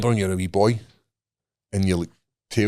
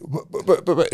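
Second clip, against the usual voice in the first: tearful stammering; 7 semitones higher than usual. Retold or imitated. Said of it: "I started greeting".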